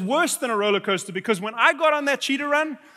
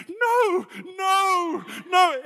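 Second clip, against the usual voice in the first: high, wailed mock panic; freaking out; 9 semitones higher, much slower than usual. Berkeley seeing his sick companion's state, high panicked wail. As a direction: imitate someone panicking, pitched way up. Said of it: "he started freaking out".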